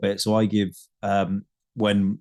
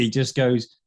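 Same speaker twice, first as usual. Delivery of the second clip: usual speaking voice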